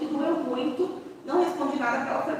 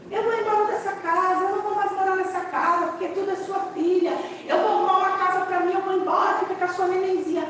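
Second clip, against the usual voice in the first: put-on higher, hurt and defiant teen; upset daughter; 7 semitones higher than usual. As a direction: imitate the angry daughter storming off. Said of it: "ela dizia pra mim".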